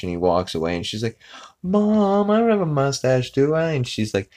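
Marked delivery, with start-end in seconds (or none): 1.6-3.7 high-pitched mock daughter's plea; worried high whine, hammed-up; whining to mom. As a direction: imitate a wounded teen, voice pitched way up. Meg upset, pleading to mom.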